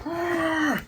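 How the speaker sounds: high, thin drawn-out cry; mock straining noise while heaving; imitated effort cry. Imitating Luffy heaving at mast.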